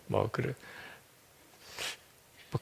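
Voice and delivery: 숨소리로 작게 흘리듯; 체념한 듯 작게 속삭여; 속삭임. Real, not acted. 체념하듯 혼잣말. (체념하듯 속삭이는 혼잣말)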